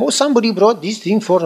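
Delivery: put-on high-pitched, half-hearted Spanish accent; attempted Spanish accent. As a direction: have a poor go at a Spanish accent. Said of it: "I can't do Spanish accent".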